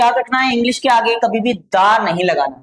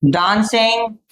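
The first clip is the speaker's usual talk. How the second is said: slow, careful English answer read out for students; slow model answer; much slower than usual. Shown as a demonstration. Teacher spelling out a model answer slowly for students. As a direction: dictate each word slowly. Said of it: "इस तरह से आप बोल सकते हैं".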